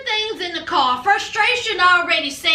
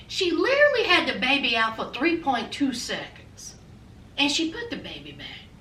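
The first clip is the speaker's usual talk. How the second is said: whispered aside; 5 semitones lower, 6 dB quieter than usual. A narrator's reading.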